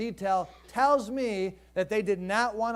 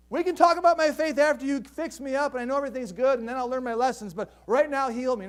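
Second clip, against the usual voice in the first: louder, pushy demand; impatient bargaining; 6 semitones higher, 6 dB louder than usual. Retold or imitated. Believer bargaining with God, pushy and impatient. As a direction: hurry God along.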